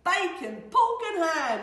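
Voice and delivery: loud, high shout from the greedy wolf; wolf crowing loudly over his dinner; gleeful shout. Acted. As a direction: shout out each meat with relish. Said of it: "He shouted".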